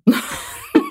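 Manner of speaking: short unvoiced laugh; breathy burst of laughter. Real, not acted. Laughs at own failure.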